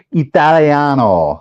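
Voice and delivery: put-on slow, showy Italian accent. Explaining how to say the title, mock Italian accent. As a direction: draw out the vowels like an Italian. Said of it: "say it with an Italian accent".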